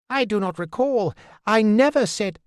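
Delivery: stricken fool, high and faltering; a dazed jester half out of himself. Acted. Stricken fool to Verity, high and faltering.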